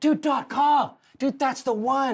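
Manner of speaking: high, bro-ish dude voice; surfer dude voice. Pretend. Mocking surfer dude about websites.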